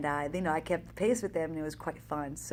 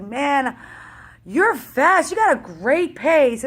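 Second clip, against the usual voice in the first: 1 excited praise; 5 semitones higher, 8 dB louder, slower than usual. Retold or imitated. Passing runner praises her.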